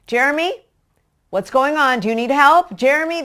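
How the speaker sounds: high, loud call that names the culprit; accusing call. Shown as a demonstration. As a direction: yell the name so he knows he's in trouble. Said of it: "You'll call out".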